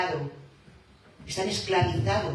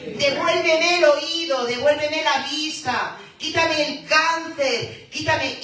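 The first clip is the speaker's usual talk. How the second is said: loud, high, slow pleading; crying out to Christ; 9 semitones higher, 7 dB louder, slower than usual. Acted. Preacher showing the sick how to cry to Christ, loud plea. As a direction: beg Christ for healing at full voice. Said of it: "pueden clamarle a Cristo".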